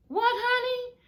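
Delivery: what, honey, squeaked in disbelief; shocked disbelief. Real, not acted. Reacting to the husband's excuse, high and shocked. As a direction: pitch jumps way up in shock.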